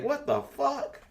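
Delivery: high, slow stunned "what the fuck"; reliving the moment the news hit; stunned disbelief at the news of a death; shocked disbelief. Retold or imitated. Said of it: "I'm like".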